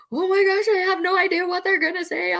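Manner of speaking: high, fast, frantic inner panic; panicked inner voice. Retold or imitated. Trainer voicing the worried helper's inner panic, high and frantic. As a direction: blurt the hidden panic, high and rushed. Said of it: "we're probably like".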